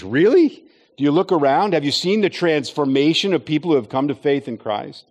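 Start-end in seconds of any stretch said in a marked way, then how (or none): 0.0-0.6 sharp, rising, louder disbelief; incredulous really. Acted. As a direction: call the claim into question. Preacher challenges the sceptic again.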